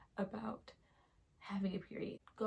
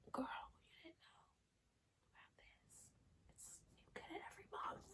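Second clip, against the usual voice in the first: whisper; 7 semitones higher, 20 dB quieter, faster than usual. Pretend. Her own advice to Carrie.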